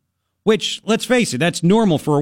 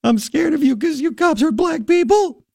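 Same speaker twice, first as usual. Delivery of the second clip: high, whiny imitation of the driver; squeaky mocking falsetto; mock crying woman; 11 semitones higher than usual. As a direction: mimic her tearful complaint, hammed-up. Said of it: "she went from crying".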